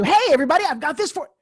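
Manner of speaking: loud, high, pushy salesperson greeting; mock the hard sell, big and eager; hard sales pitch. Imitating a pushy seller announcing to the room, loud and high. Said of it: "She immediately went into".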